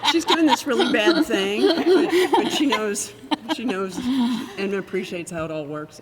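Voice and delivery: high, loud laughing quote; amused retelling, laughing and loud; laughing through his imagined thought. Retold or imitated. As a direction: giggle through the words he's thinking. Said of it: "I could tell from his face that he goes".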